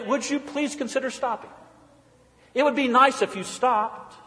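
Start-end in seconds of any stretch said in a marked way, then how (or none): 0.0-1.5 high, soft, overly polite request; mock polite. Preacher mocks a too-polite stop sign, softly. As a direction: ask far too nicely. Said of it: "It doesn't say".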